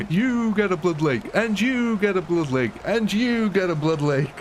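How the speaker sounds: high-pitched game-show handout, each falling away; hammed-up prize giving, pitched high; mock giveaway announcement. Streamer hands each member a Blood Lake, mock-grand announcement.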